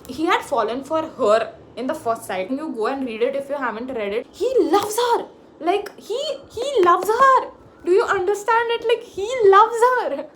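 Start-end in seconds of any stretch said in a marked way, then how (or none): 4.3-10.3 high, loud gushing shout; shouting at the top of her voice. Real, not acted. To viewers about the book hero, a high loud gushing shout. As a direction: yell it out to the viewers, overjoyed. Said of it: "I'm just shouting at my top tone".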